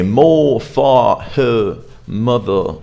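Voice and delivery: British English, R's dropped at word ends; British accent. Shown as a demonstration. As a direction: end each word on an open vowel, British style. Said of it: "in British English, we say".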